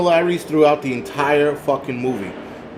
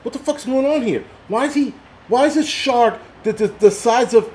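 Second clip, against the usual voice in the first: much higher, baffled outburst; exasperated disbelief; 9 semitones higher than usual. Retold or imitated. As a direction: ask it like you can't believe the screen. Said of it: "It was just like".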